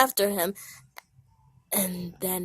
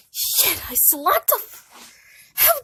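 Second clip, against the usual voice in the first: flustered self-scolding; 14 semitones higher, 7 dB louder than usual. Acted. Blackberry scolds himself.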